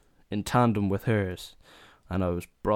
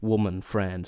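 ordinary delivery

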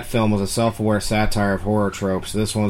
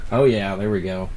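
ordinary delivery

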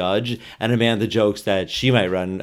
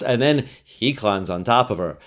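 normal talking voice

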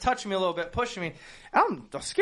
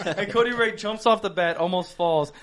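normal talking voice

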